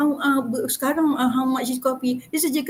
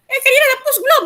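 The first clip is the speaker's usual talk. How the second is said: sudden alarmed ask; 12 semitones higher, 9 dB louder, faster than usual. Real, not acted. Anxious sudden question.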